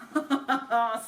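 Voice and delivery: a short, warm chuckle of a giant; petit rire. Acted. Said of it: "Le géant eut un petit rire". (petit rire du géant)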